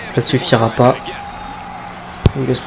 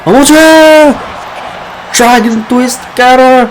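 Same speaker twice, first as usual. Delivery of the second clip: excited; 11 semitones higher, 4 dB louder than usual. Real, not acted. Commentator reacts to sudden cutter.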